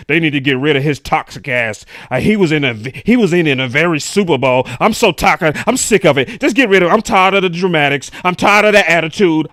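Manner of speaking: loud, exasperated shouting; fed-up fan rant. Retold or imitated. Host voices angry Dallas fans, loud and fed up. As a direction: yell it like a fan sick of the drama.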